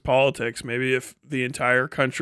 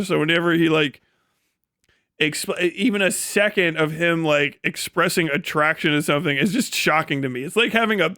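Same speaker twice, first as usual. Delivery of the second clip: normal talking voice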